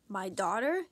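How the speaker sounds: normal talking voice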